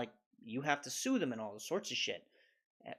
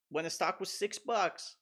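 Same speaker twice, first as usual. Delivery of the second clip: normal talking voice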